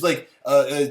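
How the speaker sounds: ordinary delivery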